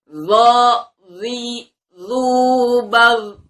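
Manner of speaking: loud, high emphatic zo syllables; makhraj drill. Shown as a demonstration. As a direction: keep every zo heavy. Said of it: "kita ulang sekali lagi".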